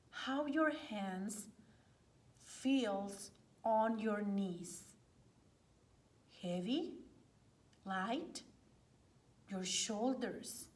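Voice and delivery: hushed yoga teacher guiding a body scan; guided meditation. Acted. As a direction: ease them gently into relaxation, phrase by phrase.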